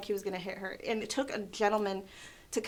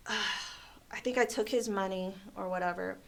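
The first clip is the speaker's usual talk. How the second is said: usual speaking voice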